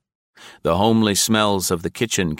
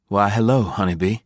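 pretended playfulness; 3 semitones higher than usual. A narrator's reading. Bound man to a shy child, coaxing playfully. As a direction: put on playfulness over a slight plea. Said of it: "undercurrent of appeal beneath its ridiculous pretense at playfulness".

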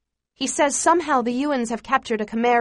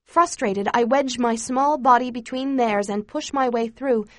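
ordinary delivery

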